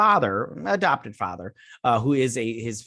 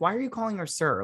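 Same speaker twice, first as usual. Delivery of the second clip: incredulous; 8 semitones higher, faster than usual. Retold or imitated. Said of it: "and I'm like".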